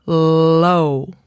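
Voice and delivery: "low" with a long, pure O; clean low. Shown as a demonstration. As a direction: draw out "low" slowly and clearly. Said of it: "choose O and say".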